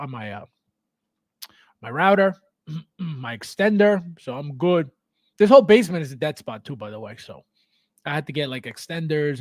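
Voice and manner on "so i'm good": usual speaking voice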